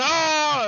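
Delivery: loud, high mock battle yell; high, loud cry imitating a man drawing his gun; acted-out yell. Gunman's cry acted out in retelling.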